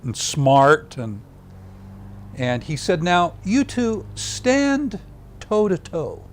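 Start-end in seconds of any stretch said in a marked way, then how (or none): 3.0-6.2 high, very slow drawn-out instruction; hammed-up professor drawing it out; teasing teacher. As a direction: stretch out each word like a teacher enjoying himself. Frank staging the classroom experiment.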